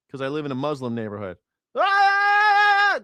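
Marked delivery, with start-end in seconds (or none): 1.8-3.0 high, loud held wail; mock call to prayer. Sarcastic neighbour mimicking call to prayer, loud wail. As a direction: blare one long note in mockery. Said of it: "to hear the call to prayer".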